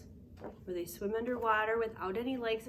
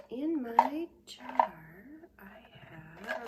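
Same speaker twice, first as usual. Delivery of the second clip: whisper; 3 semitones higher, 3 dB louder, much slower than usual. Pretend. Secret clue for the kids.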